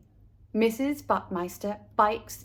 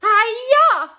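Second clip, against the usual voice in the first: high, loud martial arts yell; karate shout; 12 semitones higher, 11 dB louder than usual. Acted. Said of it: "called the Buckmeisters".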